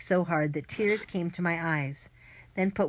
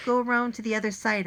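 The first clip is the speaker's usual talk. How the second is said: fast, higher urgent push; a touch of breathless urgency; gasped, out of breath; 5 semitones higher, 4 dB louder, faster than usual. A narrator's reading. Boy directing friends round the boathouse, lightly gasped. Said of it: "I gasped, out of breath".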